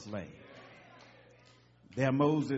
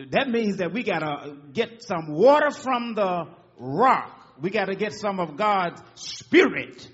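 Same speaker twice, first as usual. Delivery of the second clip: building sermon; 6 semitones higher, 8 dB louder than usual. Acted. Preaching building.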